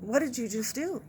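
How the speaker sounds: hushed, stunned question; a quiet, disbelieving stare put into words; imitating a stunned doctor. ER doctor silently judging the nurse, voiced as a hushed, stunned question. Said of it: "looking at me with a look of just".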